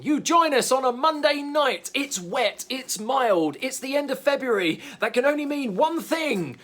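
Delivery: booming, slow, high announcer build-up; wrestling commentator. Pretend. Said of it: "Yes, I sound like a wrestling commentator".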